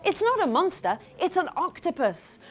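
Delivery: ordinary delivery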